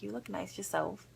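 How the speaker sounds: soft compliment. Retold or imitated. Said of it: "I'm like".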